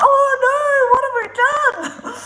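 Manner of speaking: high, loud comic wail; mock panic. Ferry passengers realising they face quarantine, mock panicked wail. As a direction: wail it in exaggerated dismay.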